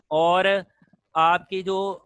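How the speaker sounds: ordinary delivery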